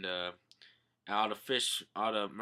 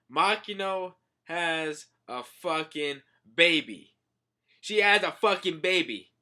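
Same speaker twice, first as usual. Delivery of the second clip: can't believe what you see; shocked; 5 semitones higher, 6 dB louder than usual. Real, not acted. Shocked at manga reveal.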